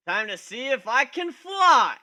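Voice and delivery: loud, slow, rising daredevil cry; thrilled yell into the void; excited. Acted. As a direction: shout it as you leap. Jumping off the cliff.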